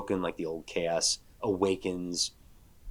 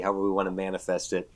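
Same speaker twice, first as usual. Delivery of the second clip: usual speaking voice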